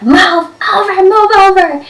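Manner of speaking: high, loud, pushy "move over"; urgent mock shout. To her friend, urging her to scoot over, loud and rushed. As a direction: shove the words out, hurrying to sit. Rushing back to friend's seat.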